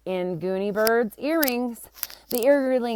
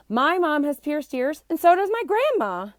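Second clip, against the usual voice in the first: put-on eager child voice; 7 semitones higher, 3 dB louder than usual. Child Ben answering eagerly.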